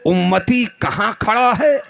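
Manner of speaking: rhetorical build to a ringing finish; fervent preaching. Real, not acted. Preacher contrasts follower and Prophet for the congregation, rising oratory. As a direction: pose the contrast, then drive it home to the crowd.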